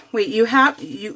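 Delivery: normal talking voice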